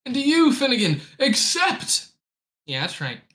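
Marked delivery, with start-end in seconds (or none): 0.1-2.1 high, solemn ritual chant voice; tribe leader. Acted. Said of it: "the tribe leader speaking to you".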